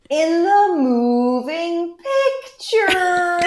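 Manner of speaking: very high, slow grandma impersonation; exaggerated, stretched-out high delivery; grandma's specific voice. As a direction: draw out each word high, hammed-up for comic effect. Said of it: "she has this very specific voice".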